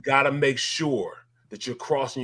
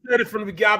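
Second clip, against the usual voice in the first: higher and louder shout; real shout; 7 semitones higher, 6 dB louder, faster than usual. Real, not acted. Ex-player shouting he called it all along. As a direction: yell it, vindicated. Fired up about San Francisco.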